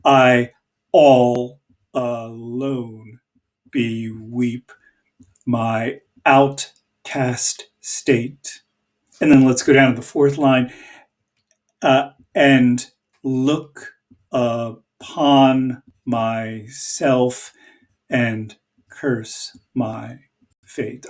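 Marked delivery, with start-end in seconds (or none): 0.0-8.6 slow, each stressed syllable leaned on; drawn-out iambic reading, "all alone" dragged slowly; scanning the meter. Shown as a demonstration. Teacher scanning Shakespeare's line aloud, slow marked beat. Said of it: "I am scanning these lines". 12.3-21.1 slow iambic reading, beats leaned on; scanning the meter. Shown as a demonstration. Teacher scanning the fourth line aloud for students, slow marked beat. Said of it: "I am scanning these lines".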